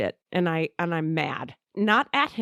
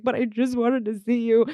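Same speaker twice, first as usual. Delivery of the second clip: higher, tearful retold plea; imitated crying; 4 semitones higher, 3 dB louder than usual. Telling him she wanted to see him, imitated crying. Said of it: "I'm crying 'cause I'm like".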